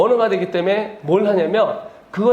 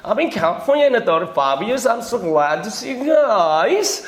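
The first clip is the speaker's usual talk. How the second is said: very slow, drawn-out mock American English; lazy showy American drawl; American accent imitation; much slower than usual. Lecturer imitates Americans bragging about California, slow mock American accent. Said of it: "미국 사람 만나면".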